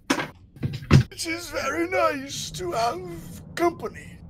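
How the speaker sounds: high, slow, moaning undead noble; zombie lord. Acted. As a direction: call out like a lost old zombie lord.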